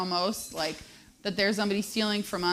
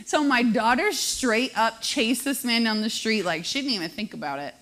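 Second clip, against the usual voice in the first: usual speaking voice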